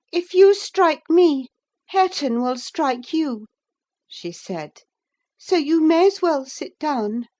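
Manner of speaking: high, loud challenge; defiant girl. A narrator's reading. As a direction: dare him to try. Catherine defies Heathcliff.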